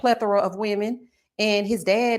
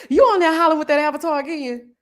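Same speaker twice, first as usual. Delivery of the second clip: husband's voice; 6 semitones higher, 5 dB louder than usual. Retold or imitated. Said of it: "He probably like".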